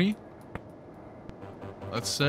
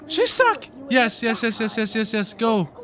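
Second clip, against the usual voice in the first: high, rapid-fire cheer; excited cheering; 9 semitones higher than usual. Real, not acted. Gamer urging the runner home, excited cheering. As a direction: pile up the cheers in a raised voice. Celebrating a big hit.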